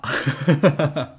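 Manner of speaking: a short shy laugh; embarrassed laugh. Real, not acted. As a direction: laugh off the awkward drill. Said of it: "I feel a little bit embarrassed".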